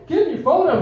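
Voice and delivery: high, loud mock-frantic demand; brother's insistent echo. Brother parrots guard's demand louder.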